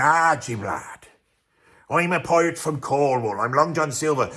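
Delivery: lightly put-on Cornish pirate growl, slowed on the opening; a playful hint of a sinister Cornish pirate; Cornish pirate accent. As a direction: Cornish seafarer voice, half-hammed. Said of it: "some sort of sinister Cornish accent".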